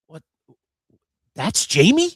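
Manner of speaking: high, loud, stunned; can't believe your eyes; shocked disbelief. Retold or imitated. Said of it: "I'm like looking at Bud like".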